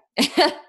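sudden high laugh; burst of laughter. Real, not acted. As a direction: laugh out loud at yourself. Laughs at her own swearing.

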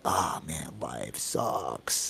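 low, quiet glum groan of words; mock world-weary. Imitating people who feel down on life, mock world-weary.

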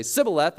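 "Sibboleth" starting with s, not sh; Ephraimite mispronunciation. Shown as a demonstration. Guards hear Ephraimites fail, "Sibboleth" with s for sh. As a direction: say "Sibboleth" with a hissed first s. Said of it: "what would come out of their mouth".

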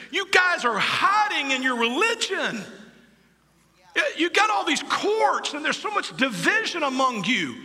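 shouted rebuke from Jesus; Jesus rebuking. Acted. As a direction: call them out as an indignant Jesus. Jesus rebukes the divided church.

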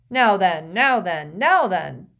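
put-on loud, booming, jolly old teacher; jovial professor. A narrator's reading. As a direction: call the class to order heartily. Slughorn calls class to order.

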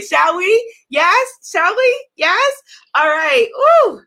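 high, loud hype questions; rising cheerleader calls; excited call. Real, not acted. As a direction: pump up the crowd. Hyping up the audience.

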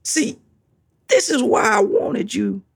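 ordinary talk

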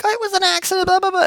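put-on squeaky girl voice brushing it off; girl insisting it was an accident, falsetto; girlfriend. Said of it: "she's like".

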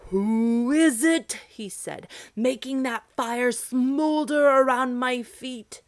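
loud, slow giant voice like rumbling thunder; terrible giant voice. Acted. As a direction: boom it down from the clouds. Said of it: "His voice was very terrible".

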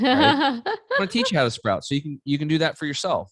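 high and laughing through the words. Real, not acted. Host laughing at own joke, laughing.

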